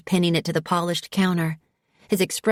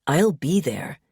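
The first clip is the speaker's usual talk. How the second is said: ordinary delivery, nothing marked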